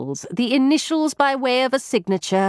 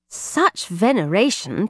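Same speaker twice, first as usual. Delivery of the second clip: ordinary delivery, nothing marked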